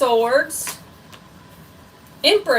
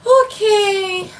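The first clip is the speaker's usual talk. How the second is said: surprised; 11 semitones higher, 6 dB louder than usual. Real, not acted. Reacting to pregnancy news.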